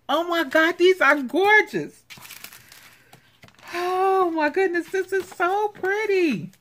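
high-pitched squealing delight; gushing delight. Real, not acted. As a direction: gush in a high, thrilled voice. Gushing over gorgeous cutouts.

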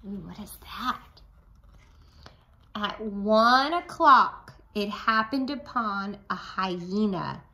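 plain reading voice

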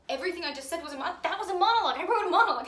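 building to a high, loud cry of triumph; excited realization. Acted. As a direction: burst out as it dawns on you. Sudden excited realisation, repeated louder.

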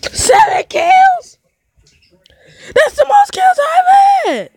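stretched, delighted victory shout; thrilled shout. Real, not acted. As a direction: yell it like you just broke your record. Celebrating seven kills.

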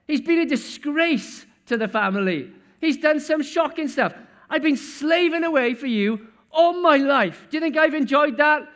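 elder son yelling at his father in front of guests; furious shouting son. Acted. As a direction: shout every grievance at dad.

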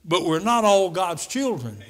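usual speaking voice

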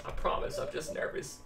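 whispered, shy apology; nervous whispered promise. Real, not acted. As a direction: whisper it to her, embarrassed. Nervous apology to his date.

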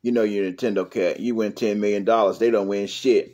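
a low mumbling side character; a low voice scoffing about the prize; deep host voice. Acted.

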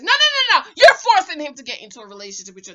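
loud, emphatic correction; scolding outburst. Acted. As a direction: cut in loudly to scold. Scolding women who push men.

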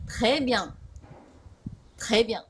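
"Très bien" with T and R fused, no vowel between; "Très bien" as two compact French syllables; correct French pronunciation. Shown as a demonstration. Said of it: "こちらが今日のポイントです".

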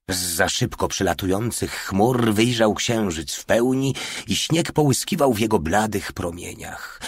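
ordinary delivery

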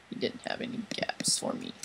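whispered complaint, very low and soft. Real, not acted. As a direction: mutter it under your breath. Whispered complaint after dying.

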